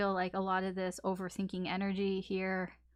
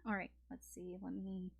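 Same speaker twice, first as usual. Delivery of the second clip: whispered to self; 9 dB quieter than usual. Real, not acted. Muttering to herself while organizing.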